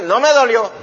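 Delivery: put-on a bruised kid insisting it didn't hurt; bruised boy insists it didn't hurt, in a mock loud voice; boy denying pain. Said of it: "muchacho que se cayó".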